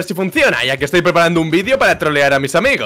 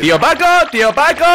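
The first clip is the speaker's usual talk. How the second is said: raised, questioning call; 7 semitones higher than usual. Pretend. Customer looking for tío Paco, calling out. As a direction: call out for someone in the shop.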